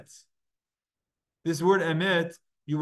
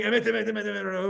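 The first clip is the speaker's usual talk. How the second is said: a touch of mockery, emet rattled off; lightly mocking emet over and over; mocking repetition; 5 semitones higher than usual. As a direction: parrot the word to poke fun. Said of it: "almost like making fun of him".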